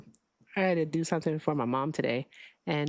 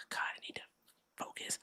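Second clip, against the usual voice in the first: whispering; 16 dB quieter than usual. Real, not acted. Muttering to herself to refocus.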